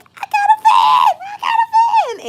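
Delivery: very high, loud jubilant shouting; excited scream. Retold or imitated. Telling everyone around her about the van, screaming with excitement. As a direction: shriek the news out twice. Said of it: "I screamed".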